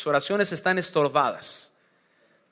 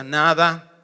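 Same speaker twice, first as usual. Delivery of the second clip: curt "nada"; 5 dB louder than usual. Acted. Wife answers "nothing.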